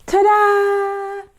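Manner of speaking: high, loud showy ta-da; big ta-da reveal. Real, not acted. Host revealing the new book to children, loud and high. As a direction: unveil it like a magic trick.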